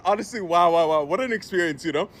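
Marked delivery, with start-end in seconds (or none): none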